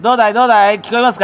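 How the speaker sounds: calling loudly into a string phone; string telephone. Real, not acted. 糸電話的に声が伝わる. (糸電話に向かって大きく呼びかける)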